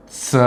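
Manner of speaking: "स" as a lone short suh; letter sound. Shown as a demonstration. Teacher giving the second way to pronounce C, letter sound. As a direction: say "स" on its own, the soft sound of C. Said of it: "तीन तरीके से यहाँ पर हम इसको pronounce".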